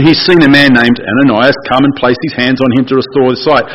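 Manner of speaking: ordinary talk, nothing marked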